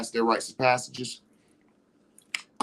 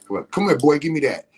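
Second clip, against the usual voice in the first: raised, higher call across the village; loud call; 3 semitones higher, 6 dB louder than usual. Retold or imitated. Elder loudly calling the uninitiated man over, raised call. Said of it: "you hear somebody say".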